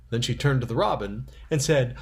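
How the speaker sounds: ordinary delivery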